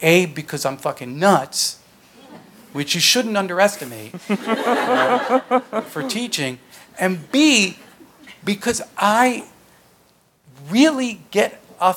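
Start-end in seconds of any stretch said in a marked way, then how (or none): 4.2-5.8 high burst of real laughter. Real, not acted. Laughing at her own joke about teaching.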